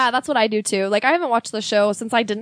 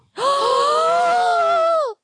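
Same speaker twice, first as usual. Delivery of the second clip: shocked gasp. Real, not acted. Shocked at Rachel name reveal.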